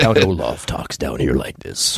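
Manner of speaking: Count Olaf. Shown as a demonstration. Actor showing interviewer the villain's voice, low and menacing. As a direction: drop it down low and draw it out. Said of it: "like, low and menacing".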